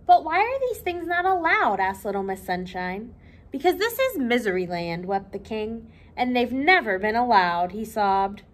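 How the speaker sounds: lively read-aloud for children, voicing each character; storybook reading. A narrator's reading. Librarian reading Little Miss Sunshine to children, lively storytelling.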